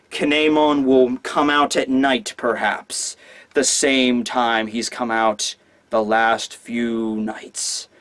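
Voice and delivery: low, slow, hushed spooky delivery; ominous hint. Acted. Law hinting the samurai is a ghost, ominous. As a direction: let it sink away eerily toward the end.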